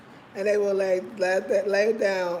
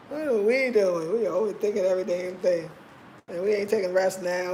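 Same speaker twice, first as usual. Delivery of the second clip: normal talking voice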